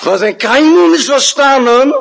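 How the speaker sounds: high-pitched, drawn-out exclamation; acted king's outburst; emphatic challenge. King's rhetorical outburst to the condemned man.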